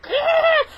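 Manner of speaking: very high, loud crazed scream; horror movie roar. Shown as a demonstration. Scaring off a bully, horror-movie scream. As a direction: scream like a slasher villain. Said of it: "Like Freddy versus Jason".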